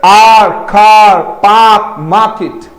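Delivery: कार, पार्क, मार्केट all said slowly without r; no r sound. Shown as a demonstration. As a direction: stretch each word, drop the r. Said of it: "आर मत बोलो".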